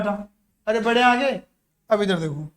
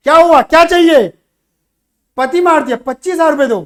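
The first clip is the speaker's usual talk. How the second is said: loud, higher-pitched indignant demand; acted angry confrontation; 3 semitones higher, 10 dB louder than usual. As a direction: burst out as in a staged quarrel. Repeat of the story's confrontation. (गुस्से का अभिनय, तेज़ ऊँची आवाज़ में)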